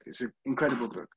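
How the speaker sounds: quick, broad Yorkshire voicing of the line; Yorkshire accent. Teacher to class, showing how Yorkshire people talk, in a Yorkshire accent. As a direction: say it like a Yorkshireman would. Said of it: "where they talk like that".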